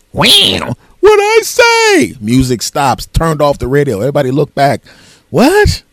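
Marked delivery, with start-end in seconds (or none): 0.1-0.7 loud imitated record-scratch effect; high, screeched scratch noise. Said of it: "they have that sound effects". 1.0-2.1 drawn-out, very high sitcom shout; hammed-up shriek of disbelief; mock outburst. Record-scratch reaction to crude remark. 5.3-5.8 loud, very high rising squawk; hammed-up shriek of disbelief; mock shock. Everybody looks back, stunned.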